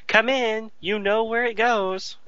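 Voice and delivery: high-pitched comic woman's line; mock shower invitation. Woman in the shower lets the ensigns in, mock high voice.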